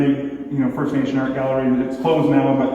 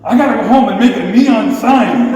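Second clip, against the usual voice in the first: higher, louder, fired-up rant; heated, raised-voice declaration; angry outburst; 7 semitones higher, 7 dB louder than usual. Retold or imitated. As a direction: blurt it out in rising anger. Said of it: "angrier and angrier".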